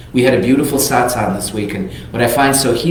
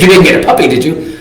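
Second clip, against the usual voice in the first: incredulous laughing accusation; 7 semitones higher, 8 dB louder than usual. Retold or imitated. Husband realises, laughing.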